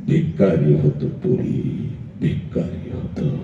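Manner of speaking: slow, near-whispered lament; hushed sorrow. Acted. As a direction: drop to a breath and let it sink in. Hushed climax about father's ruin.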